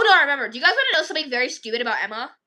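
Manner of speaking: ordinary talk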